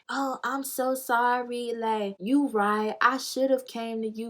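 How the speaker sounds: slow, drawn-out imitated apology; mock apology. Storyteller imitating the friend's apology, slow and mocking.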